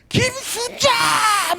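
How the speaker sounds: very high, slow yelled name; shouting a name. Retold or imitated. Recruit shouting his mother's name in the rain, slow high yell. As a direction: yell it out with all you have.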